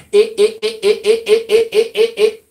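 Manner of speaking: stuttering one high, loud syllable; mock a stuck speaker, rapid even repeats; high, loud broken-record stammer; mock Obama stammer. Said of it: "Obama goes".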